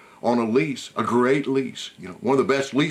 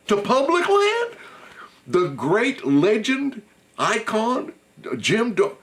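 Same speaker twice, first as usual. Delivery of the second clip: high, slow, laughing disbelief at a fence-line stand; incredulous disbelief; 8 semitones higher than usual. Retold or imitated. Storyteller recalling his thought about Jim, in high slow disbelief. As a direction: marvel that a legend hunts beside public land. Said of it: "I thought".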